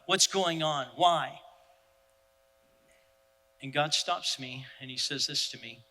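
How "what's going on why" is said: loud, rising, furious demands; angry shout at God. Retold or imitated. Father giving God what for, angry loud shouting. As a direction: hurl the questions at God, louder each time. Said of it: "I'm giving God what for".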